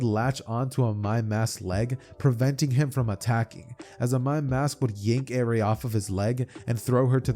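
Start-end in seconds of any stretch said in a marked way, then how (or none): none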